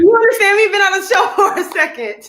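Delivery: playfully offended, laughing it off; laughing mock indignation. Real, not acted.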